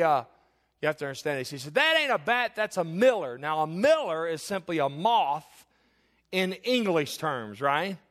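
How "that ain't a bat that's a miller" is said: high, loud mock correction; dismissive grandpa. Grandpa correcting the scared grandchild, acted loud dismissal. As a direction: set the kid straight crossly. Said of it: "very comforting people. Not".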